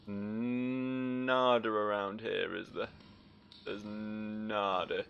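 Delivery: very slow, stretched words; drawn-out. Acted. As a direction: drag out every word. Drawn-out search, nothing found.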